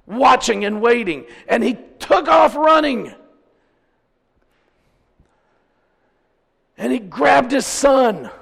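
loud, high, drawn-out proclamation; full-force preaching. Acted. Preacher telling of the father spotting his son, proclaiming. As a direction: preach it out, voice lifted and stretched.

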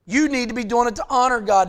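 normal talking voice

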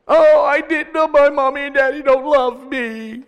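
high, loud, whiny wail; hammed-up poor-me child whine; mock self-pity. Preacher mocking self-pity as an unloved child, whining. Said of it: "wallowing in our self-misery, in our pity".

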